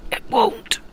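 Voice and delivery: tiny whispered high voice; a squeaky unvoiced retort; mock voice. Gamer answering himself in a tiny whisper. Squeaky self-reply about accents.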